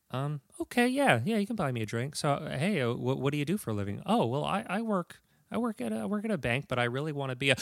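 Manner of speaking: soft hesitant voice; quiet unsure reply; timid quiet woman. Acted. Acting woman at bar.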